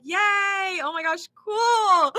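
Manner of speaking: high "Yay", then a slow, drawn-out "cool"; hammed-up fake cheer for a friend's pregnancy; forced enthusiasm. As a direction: pretend to be thrilled and barely manage it. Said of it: "in the way that you say it too".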